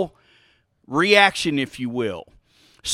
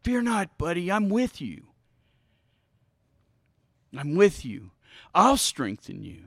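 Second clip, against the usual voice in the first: gentle reassurance; 2 semitones lower than usual. Acted. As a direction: drop down and comfort with long pauses. Gently voices comfort to frightened person.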